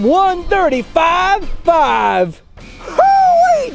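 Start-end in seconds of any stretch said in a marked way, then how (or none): 2.8-3.7 high, cheering hoot; excited whoop. Acted. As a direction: whoop it up with joy. Host cheering the power verse.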